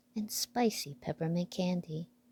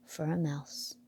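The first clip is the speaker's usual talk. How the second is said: ordinary delivery, nothing marked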